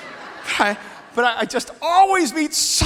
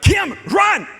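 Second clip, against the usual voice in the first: urgent call; 7 semitones higher, 4 dB louder than usual. Retold or imitated. Rushing wife through airport.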